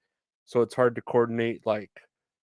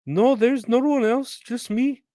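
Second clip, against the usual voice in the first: much higher, slow mock denial; denying customer; 12 semitones higher, 4 dB louder, slower than usual. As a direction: pitch way up and drag out the fake denial. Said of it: "customer denies on phone".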